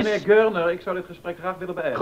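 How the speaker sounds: loud indignant brush-off; curt angry dismissal. Acted. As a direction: end the talk sharply, voice up. Cutting off the visitor.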